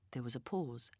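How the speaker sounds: ordinary delivery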